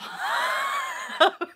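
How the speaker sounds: very high squealing laugh; amused laugh. Real, not acted. Joking about talking to herself, high laughter.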